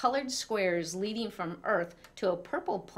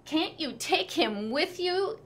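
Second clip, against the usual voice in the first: higher whiny plea; older brother whining to mom; 9 semitones higher than usual. A narrator's reading. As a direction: whine to get rid of brother. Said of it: "Walter whined".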